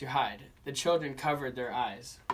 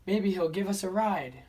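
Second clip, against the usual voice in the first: put-on children's voices; 6 semitones higher, 4 dB louder than usual. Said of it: "asked the children".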